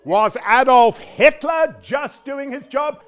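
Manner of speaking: high, loud, drawn-out challenge; accusing shout. Acted. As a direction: throw the question back as an outraged shout. Daughter's accusing comeback in quarrel.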